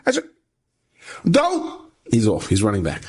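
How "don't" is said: sudden high-pitched cry; calling out. Retold or imitated. Student calling after his friend running back, alarmed cry.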